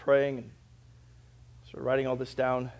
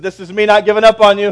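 loud, raised declaration; triumphant; 7 semitones higher, 16 dB louder than usual. Retold or imitated. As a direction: make your point with a grin. Pastor's triumphant callback line.

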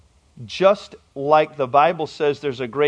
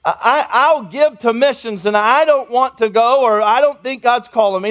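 higher, pleading excuse-maker; reluctant churchgoer; 8 semitones higher, 5 dB louder than usual. Retold or imitated. Pastor mimics a churchgoer dodging missions, pleading. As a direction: beg off the call, half-whining. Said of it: "And he said".

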